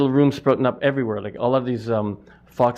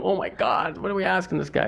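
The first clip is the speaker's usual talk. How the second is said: higher and softer, mock embarrassed gasp; embarrassed customers; 7 semitones higher, 4 dB quieter than usual. Customers realizing what they asked the butcher, higher softer mock embarrassment. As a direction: imitate shoppers catching their own blunder. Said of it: "And so they go".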